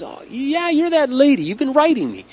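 high-pitched, acted 'oh, it's you'; dawning recognition. Acts out Leakey's sudden recognition.

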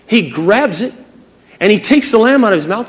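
ordinary delivery

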